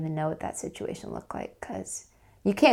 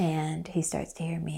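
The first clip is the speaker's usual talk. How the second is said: ordinary talk, nothing marked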